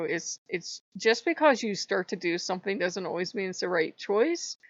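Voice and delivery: ordinary delivery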